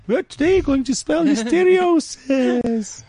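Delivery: high, very slow, overdone teacher voice; mock announcer lady. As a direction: spell it out like a patronising teacher. Imitating the lady's announcement.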